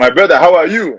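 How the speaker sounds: hearty put-on African accent. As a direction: greet a brother in an African accent. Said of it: "my accent".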